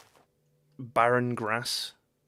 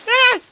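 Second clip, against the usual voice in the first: loud, very high shriek; startled scream; 14 dB louder than usual. Real, not acted. Player caught off guard, loud high scream. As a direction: scream out at a sudden scare. Sudden scare during play.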